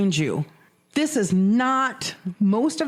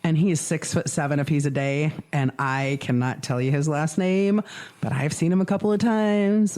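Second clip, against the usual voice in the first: ordinary talk, nothing marked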